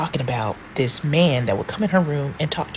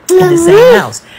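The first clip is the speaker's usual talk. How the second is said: emphatic loud; 14 dB louder than usual. Real, not acted. Emphatic story climax.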